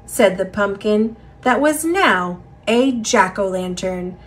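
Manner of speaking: plain reading voice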